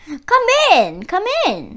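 high, smiling welcome; smiling fox greeting. A narrator's reading. Fox welcoming Miss Stork to dinner, sly smiling greeting. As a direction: greet her with a sly smile. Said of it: "smiled Mister Fox".

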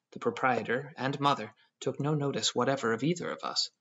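usual speaking voice

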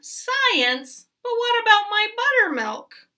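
high, loud fussy protest; fussing queen. A narrator's reading. Queen worried about her buttermilk, fussing loudly. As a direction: fuss shrilly, pitch jumping way up then falling. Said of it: "fussed the queen".

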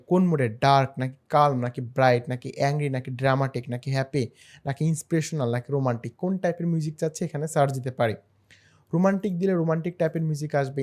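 ordinary talk

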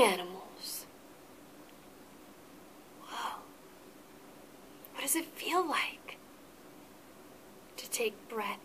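hushed mindful-minute voice for the class; guided meditation whisper. Acted. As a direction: guide the children into calm imagining.

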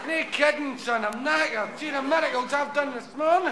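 Jesus moaning about his miracle shift; knackered Jesus. Acted. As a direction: complain like a worn-out worker. Tired Jesus complains.

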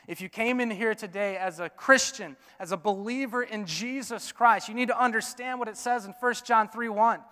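usual speaking voice

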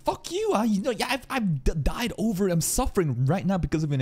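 high, mock exasperated retort; Amelia retorting. Streamer voicing Amelia snapping back, high mock retort. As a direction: squeak it out like a hurt girl. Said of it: "And then Amelia just retorts".

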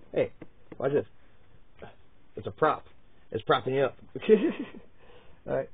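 normal talking voice

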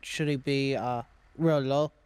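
ordinary delivery

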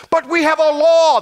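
loud, high indignant protest; objecting loudly. Acted. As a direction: cut in hard to object.

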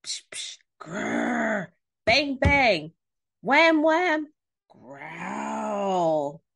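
hushed psh, growly grr, bouncy bangs; imitated monster noises. Storyteller acting out the mysterious noise from Duck's house, imitated noises. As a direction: act out the strange noises, building then growling.

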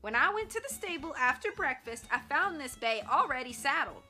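Shorty defending himself against Tex; indignant protest. Acted. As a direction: refuse the blame loudly. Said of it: "Shorty put in".

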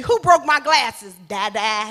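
loud mock demand, then baby babble; mock angry parent. Parent yelling about broken glasses, baby answers da da. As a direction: bark the question, answer da da. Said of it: "you know, you".

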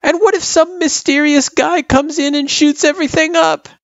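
put-on high, loud child demanding an answer. A narrator's reading. Girl challenging her friend about a mysterious intruder, demanding. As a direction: press the worry hard in a kid's high voice. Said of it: "she demanded".

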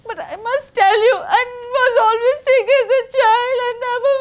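high, whiny fake-sick spider; trickster tale voices. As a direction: plead like a sly trickster. Anansi pleading to hen.

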